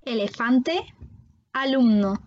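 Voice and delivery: "Elefante, alumno" with E as é and U as OU; Spanish vowels in "Elefante, alumno": é, ou; E et OU espagnols. Shown as a demonstration. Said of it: "E et U vont se prononcer E et". (« Elefante, alumno » avec E dit é, U dit OU)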